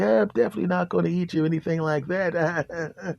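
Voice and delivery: sly dog voice. Acted. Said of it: "the dog's like".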